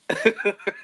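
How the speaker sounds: quick real laugh bursts out; short laugh. Real, not acted. Laughing at Irwin's claim, a short real laugh. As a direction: laugh at how absurd it is.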